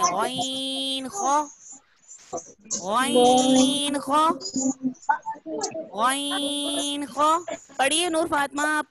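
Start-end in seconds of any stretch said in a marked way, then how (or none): 0.0-7.4 गैन drawn out thick from the throat, not Urdu गाफ; thick throat letters. Shown as a demonstration. Teacher correcting a child's गैन to the thick Arabic sound. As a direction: push गैन and खो heavy from the throat. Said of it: "इसको मोटा पढ़ना है".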